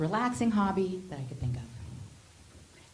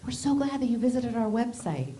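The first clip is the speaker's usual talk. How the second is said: chirpy company email voice; 6 semitones higher than usual. Retold or imitated.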